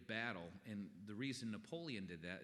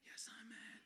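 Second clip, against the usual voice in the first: whispered reply; 14 semitones higher, 5 dB quieter than usual. Acted. Answering Satan's goading.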